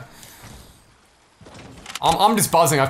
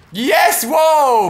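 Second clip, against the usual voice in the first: elated high yell; excited shout; 10 semitones higher, 5 dB louder than usual. Real, not acted. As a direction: whoop it out with joy. Big play in the game.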